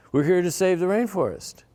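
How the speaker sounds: high, loud and proudly earnest; earnest declaration. Retold or imitated. Telling the anthropologist his mission, high and earnest. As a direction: announce the mission with wide-eyed conviction.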